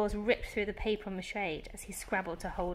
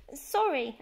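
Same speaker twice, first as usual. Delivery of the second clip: a lightly voiced boy's flustered sorry, higher and louder; spluttered; 7 semitones higher, 5 dB louder than usual. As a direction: blurt it out, caught red-handed. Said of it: "I spluttered".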